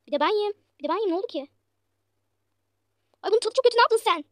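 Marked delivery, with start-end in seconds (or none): 3.2-4.2 high, loud outraged complaint; disgusted customer. Acted. Customer tastes the bad dish and scolds the cook, outraged. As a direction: spit out the taste and accuse the cook.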